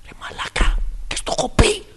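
hushed, exasperated whisper; whispered scolding. Acted. Woken listener scolding the noisy one, whispered scolding. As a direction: scold without waking anyone.

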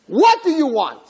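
high, mock-irate shout; exasperated outburst. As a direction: snap it in theatrical frustration. Satan confronts the little angel.

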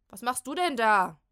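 loud calling question; shouting. Acted. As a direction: shout down the stairs. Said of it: "ruft Molly von oben". (laut fragen)

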